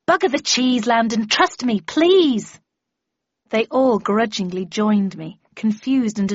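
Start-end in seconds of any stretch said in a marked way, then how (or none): 0.1-2.6 loud, insistent override; pressing appeal, building to a cry; urgent plea. Acted. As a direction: cut off the objection, then rise to a high "please". Urgent plea to leave at once.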